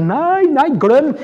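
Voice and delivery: imitating a zealot brushing off the Bible, high and loud; mock charismatic outburst. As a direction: shout it off like an overexcited believer. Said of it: "når du har lest".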